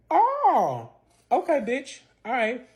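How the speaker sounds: high, drawn-out affronted reaction; high-voiced mock acceptance; retold indignation. Acted reaction to being rejected.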